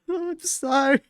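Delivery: high, strained sorry through giggles; squeaky laughing sorry. Real, not acted. Streamer apologizing to chat, squeaking with laughter.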